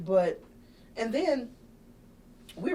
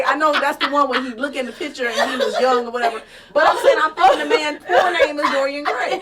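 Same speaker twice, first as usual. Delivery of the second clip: louder, higher words through laughter and tears; laughing; 7 semitones higher, 12 dB louder than usual. Real, not acted. Comedian laughing to her cohost over a Dorian Gray mix-up, loud and teary. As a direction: laugh at your own mix-up while explaining.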